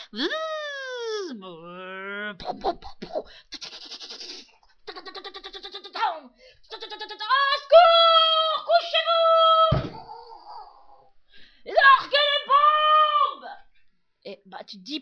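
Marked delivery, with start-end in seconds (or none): 0.1-2.4 drill noise imitation. 2.4-13.5 slow, high screaming alarm; panicked shouting. Pretend.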